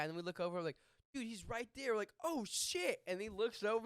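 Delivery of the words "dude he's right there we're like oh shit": excited retold discovery. Retelling friends spotting costumed man.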